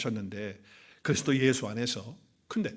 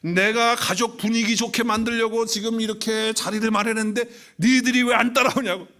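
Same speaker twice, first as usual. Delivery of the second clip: raised, louder mock rant of a drunk dad; imitating angry father; 6 semitones higher, 5 dB louder than usual. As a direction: scold the family like the fed-up father. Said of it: "열받아가지고". (화가 난 아버지를 흉내 내며 높고 크게 따지듯)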